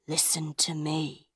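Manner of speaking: soft, low warning; hushed, drawing the two men close; quiet. A narrator's reading. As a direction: lean in and say it quietly. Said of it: "she said quietly".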